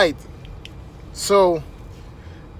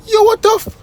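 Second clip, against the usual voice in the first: much higher, louder mock yelp; stunned attendant; 4 dB louder than usual. Parking attendant sees car move alone, high shocked yelp. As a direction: imitate a man losing it in shock. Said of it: "The guy was like".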